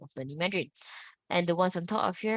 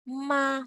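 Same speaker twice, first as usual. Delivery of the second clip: MA held high and perfectly level; high flat tone; 7 semitones higher than usual. Shown as a demonstration. Teacher modelling the first tone, high and flat. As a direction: sing out MA on one high, flat note. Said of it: "but I go flat".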